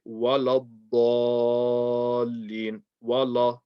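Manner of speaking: "Wala ddallin" with dark "waw-law" and a long held "ddaa"; wrong, heavy waw and lam. Shown as a demonstration. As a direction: thicken both syllables of "Wala" to "waw-law". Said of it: "people make these heavy".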